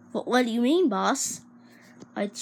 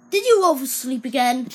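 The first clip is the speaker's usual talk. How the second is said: scolding boss; 2 semitones higher, 7 dB louder than usual. Acted.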